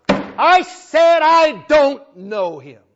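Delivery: Peter schreeuwt hoog en luid, langzaam: ik ken hem niet; emphatic denial. Acted. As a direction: vloek het eruit, hartstochtelijk. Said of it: "emphatically, passionately, with an oath".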